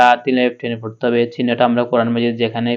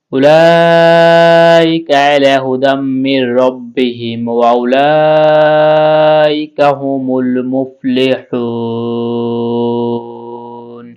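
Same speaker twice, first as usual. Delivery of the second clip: slow melodic chanted recitation, a little louder; Quran recitation; 6 dB louder, much slower than usual. Acted. Teacher reciting the whole verse after spelling it out, melodic chant. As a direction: chant the verse slowly with long held vowels.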